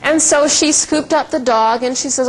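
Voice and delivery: normal talking voice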